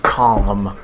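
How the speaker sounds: "Calm" as kahlm. Shown as a demonstration. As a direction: say "Calm" with the L sounded. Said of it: "all you people just saying calm".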